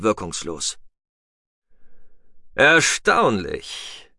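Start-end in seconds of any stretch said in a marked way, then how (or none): 2.5-4.1 very slow, drawn-out single word; slow, deliberate, strangely calm remark; unnatural calm. A narrator's reading. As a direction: stretch it out with eerie composure. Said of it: "sagte Atlan in unnatürlicher Ruhe".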